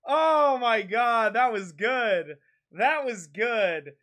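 very slow, stretched-out words; long, relieved exhale of words; savouring relief. Real, not acted. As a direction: draw each word out, soaking in the win. Savouring the win after shouting.

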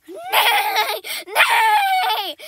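high, shouted "nay" with laughter; whinny loudly like a distressed horse; loud horse neigh. Pretend. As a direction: kid voicing the mare, shouting. Voicing the horse in labour.

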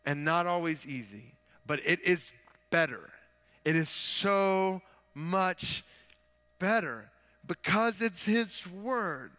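emphatic pulpit delivery, key words punched loud; forceful preaching. Real, not acted. Preacher urging the church to trust God's word, forceful preaching. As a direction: preach it with rising conviction.

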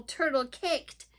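usual speaking voice

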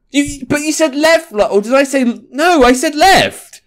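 high, indignant back-and-forth; exasperated arguing. Real, not acted. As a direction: argue with the game in disbelief. Arguing with the game.

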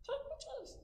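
very high squeaky mouse. Acted. Mouse urges bear to take cheese.

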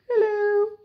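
put-on high squeaky old lady. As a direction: greet like a tiny old lady. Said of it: "little old lady".

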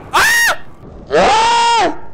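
real high-pitched startled scream; very loud, piercing jump-scare scream. Real, not acted. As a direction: shriek in sudden fright. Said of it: "I screamed like such a little girl".

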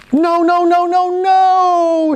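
high, loud, slow string of nos; shouting no. Acted. Mom shouting at the boy about to jump in, loud. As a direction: stop him before he jumps. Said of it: "shouts the mom".

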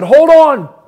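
yelled warning. Retold or imitated. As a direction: brace everyone with a shout. Dad yells before hitting tree.

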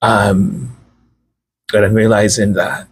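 low, worn-out voice running dry; voice almost gone. Real, not acted. Speaker apologizing to viewers, voice nearly gone and low. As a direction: talk on gently with what little voice is left. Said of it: "before I have no voice left".

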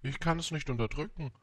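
lightly voiced bewildered prince; confused little prince. A narrator's reading. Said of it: "antwortete der kleine Prinz ganz verwirrt".

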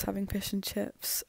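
usual speaking voice

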